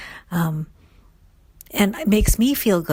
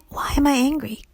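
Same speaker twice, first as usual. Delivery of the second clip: soft unvoiced whisper, a little higher; whispered self-question; 7 semitones higher, 4 dB quieter than usual. Shown as a demonstration. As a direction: ask it under your breath to yourself. Inner question repeated.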